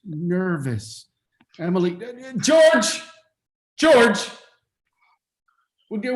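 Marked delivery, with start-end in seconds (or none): 2.4-4.3 louder call across the room. Acted. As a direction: summon him from afar. Father calls the groom over.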